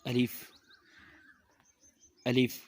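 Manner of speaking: short, clean "الف"; correct alif pronunciation. Shown as a demonstration. Teacher shows pupils the letter, correct short "الف". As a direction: say "الف" briefly, without stretching either syllable. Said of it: "صحیح حرف ہے الف".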